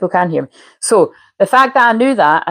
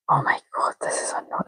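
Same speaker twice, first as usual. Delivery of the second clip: breathy frustrated aside; exasperated whisper; 10 dB quieter than usual. Real, not acted. Host whispers her frustration to the live viewers. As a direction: whisper it under your breath, fed up.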